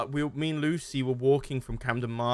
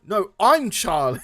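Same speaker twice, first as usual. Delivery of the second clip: higher and louder, laughing through it; laughing loud retelling; 10 semitones higher, 9 dB louder than usual. Retold or imitated. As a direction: blurt the seller's line while cracking up. Pushy seller insisting, teller laughing.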